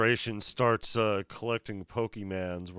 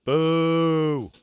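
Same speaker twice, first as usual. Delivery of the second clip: booing; 8 semitones higher, 6 dB louder than usual. Real, not acted. Friend booing weak pack hits.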